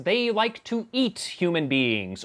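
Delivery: ordinary talk, nothing marked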